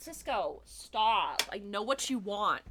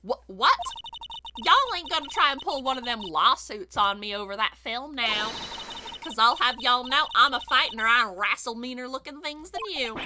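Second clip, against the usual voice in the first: put-on generic Southern accent; 2 semitones higher, 3 dB louder than usual. Photographer warning off a lawsuit, in a combative Southern accent. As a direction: warn them off in a feisty country twang. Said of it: "doing a very generic Southern accent".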